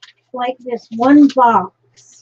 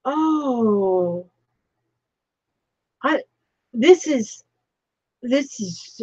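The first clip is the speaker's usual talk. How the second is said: choking up; 3 dB quieter, slower than usual. Real, not acted. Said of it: "I'm trying not to cry".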